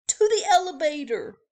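fast, high, urgent; urgent hurry. Acted. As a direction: rush him to the lift. Fleeing spiders.

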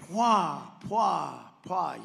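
playful imitation of the landing thump; imitated landing thuds. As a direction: make the sound of the plane landing. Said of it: "And then you hear".

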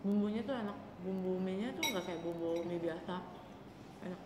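talking between bites; mouth full, chewing. Real, not acted. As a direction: food in mouth. Seen 0: Takes noodles by fork, talks with full, moving cheeks throughout.

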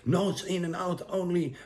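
high, insistent know-it-all mock of "Nose in and out only"; mocking a nitpicking commenter; mock critic. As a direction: lecture like a smug commenter, "Nose" pitched high. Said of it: "hate comments".

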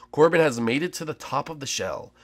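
slow, hushed nature-show narration; documentary narration. Acted. Player narrates Corbin's escape like a nature documentary. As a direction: narrate the chase like a wildlife film.

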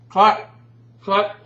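loud, high call of cut; shouting. Real, not acted. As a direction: yell it across the set. Calling cut on shoot.

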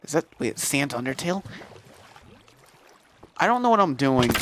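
ordinary talk

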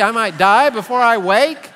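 put-on a four-year-old realising "die" is possible; alarmed child. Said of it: "the four-year-old is like".

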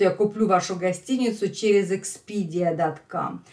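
ordinary talk, nothing marked

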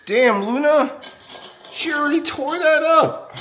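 high, startled exclamation; shocked at what the dog did; surprised outburst. Real, not acted. Dog wrecked something, exclaiming.

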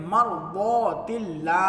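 मरदौतिल्ला slow with the द read full and heavy; slow model of मरदौतिल्ला; heavy daad. Shown as a demonstration. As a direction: demonstrate मरदौतिल्ला with a thick द. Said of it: "पुर पढ़ना पड़ेगा जो दौद को".

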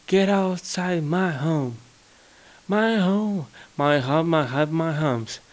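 mock a proud owner chasing someone out; mock proud homeowner. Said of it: "like people say".

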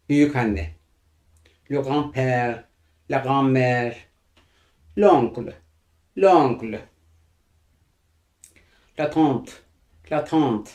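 usual speaking voice

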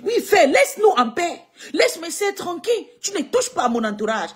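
high, loud warning to back off; fierce warning. Real, not acted. Warning the insulter to leave her sisters alone, fierce. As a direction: tell him to leave them alone, hard.

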